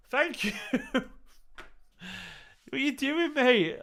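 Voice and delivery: very high, laughing through the thanks; flustered laughing. Real, not acted. Laughing at the generous donation.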